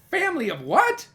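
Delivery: high, loud questioning desk sergeant; baffled, voice climbing on what; puzzled Clancy. Acted. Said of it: "Clancy said".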